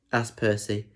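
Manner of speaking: ordinary delivery, nothing marked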